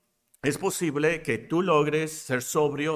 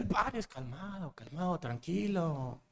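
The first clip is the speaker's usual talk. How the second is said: much softer, slow calming voice; mock soothing; 10 dB quieter than usual. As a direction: soothe a flustered patient, hushed. (voz suave y lenta, calmando al paciente)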